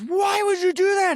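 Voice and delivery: high, loud disbelieving cry; high-pitched hammed-up exasperation; mock outraged yell. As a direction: yell it like you are appalled. Acting out a shocked question.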